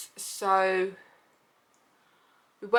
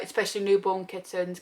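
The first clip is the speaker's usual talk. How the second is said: ordinary talk, nothing marked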